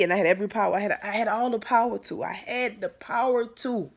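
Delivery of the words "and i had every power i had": normal talking voice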